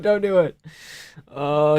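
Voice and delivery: ordinary delivery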